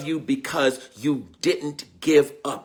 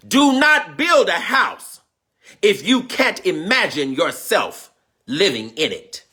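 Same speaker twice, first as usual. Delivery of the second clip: proclaiming; 4 semitones higher, slower than usual. Real, not acted. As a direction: announce it like scripture. First proclaiming the proverb.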